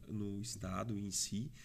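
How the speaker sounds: ordinary talk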